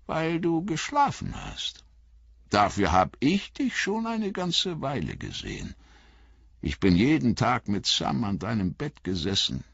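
put-on high, cheerful old hobbit; high old hobbit voice, warmly pleased; old hobbit Bilbo. A narrator's reading. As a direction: greet him like a fond old uncle.

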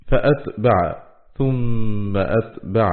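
very slow, deliberate tajweed; drawn-out recital of scripture; Quranic recitation. Shown as a demonstration. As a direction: recite each verse word slowly with held sounds. Quranic words recited within lesson.

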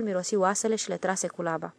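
usual speaking voice

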